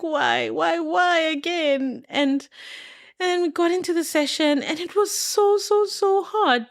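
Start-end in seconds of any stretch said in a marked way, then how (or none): 0.0-2.0 drawn-out, slowed why again; slowed, despairing self-quote; despairing whine. Retold or imitated. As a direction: moan it in dismay. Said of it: "I was like".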